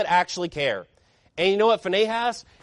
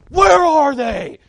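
loud, high, drawn-out furious yell; angry shout; 9 semitones higher, 10 dB louder, much slower than usual. Retold or imitated. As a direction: bellow it like an enraged friend storming in. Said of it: "this angry Arabic man".

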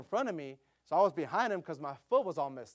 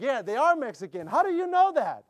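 high, loud delighted question; playfully excited retort to the teenagers; 8 semitones higher, 8 dB louder than usual. Retold or imitated. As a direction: burst out with eager surprise. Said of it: "I'm like".

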